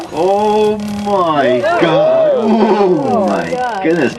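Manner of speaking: imitating a gasping tourist. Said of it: "she says".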